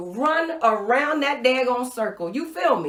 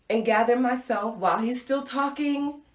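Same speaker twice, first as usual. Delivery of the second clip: ordinary delivery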